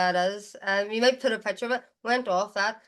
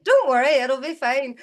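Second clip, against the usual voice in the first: mock carefree; 4 semitones higher, 7 dB louder than usual. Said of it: "I'm like".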